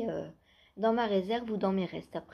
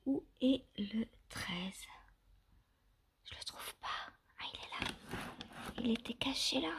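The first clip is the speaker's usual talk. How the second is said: whispering; 7 dB quieter than usual. Real, not acted. Searching the calendar under her breath.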